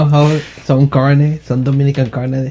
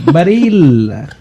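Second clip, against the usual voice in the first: mock Italian accent; 3 semitones higher, slower than usual. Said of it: "you can also sound Italian".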